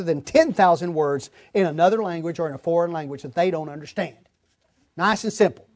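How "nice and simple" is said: usual speaking voice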